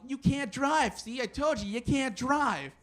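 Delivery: Angry Bob. Acted. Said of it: "I kept hearing in the back of my mind".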